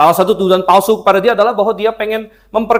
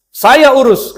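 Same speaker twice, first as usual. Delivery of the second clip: curt queen; 8 semitones higher, 6 dB louder, slower than usual. Retold or imitated. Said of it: "kata Isabel".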